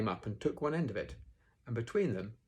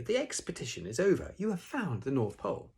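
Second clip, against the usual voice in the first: raised, solemn proclamation; Christopher Robin solemnly; 7 semitones higher, 3 dB louder than usual. A narrator's reading. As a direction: announce it as a solemn discovery. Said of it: "said Christopher Robin solemnly".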